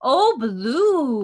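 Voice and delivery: high, loud coo to a pet; cooing sympathy. Real, not acted. Host to the crying dog, cooing. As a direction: call out to the poor whining dog.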